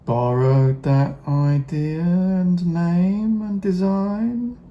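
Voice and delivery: pointed sing-song insinuation. Real, not acted. Vlogger hinting Games Workshop copied Rogue Trooper, arch rising drawl. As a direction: stretch every word knowingly, pitch rising steadily.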